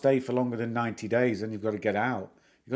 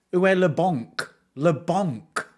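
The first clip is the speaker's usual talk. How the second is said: put-on raised, English-accented French; schoolboy French; 5 semitones higher, 4 dB louder, slower than usual. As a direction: punch out the French with cheeky English vowels.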